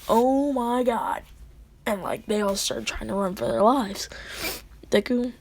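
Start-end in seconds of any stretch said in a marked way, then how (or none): 0.1-1.2 drawn-out, dawning horror; mock dread. Classmates realising they face the Ripper, drawn-out mock dread. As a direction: stretch it out in acted alarm.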